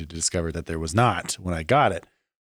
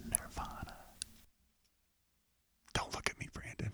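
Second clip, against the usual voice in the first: unvoiced secret whisper; whispering a secret password; 22 dB quieter than usual. Real, not acted. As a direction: hush the answer into the mic. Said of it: "I'm gonna whisper it into the microphone".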